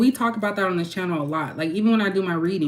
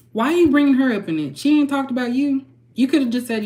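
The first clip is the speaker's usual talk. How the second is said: pitched up, exasperated at Lamar Odom; incredulous, pitch climbing higher; exasperated rant; 6 semitones higher, 3 dB louder than usual. Real, not acted. Vlogger scolding Lamar Odom for dragging Taraji in, exasperated.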